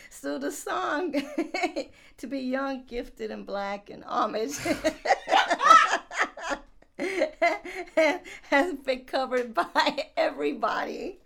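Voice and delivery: high, loud, breaking into laughter; trying not to laugh. Real, not acted. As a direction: push through the giggles. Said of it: "I'm trying not to laugh so early".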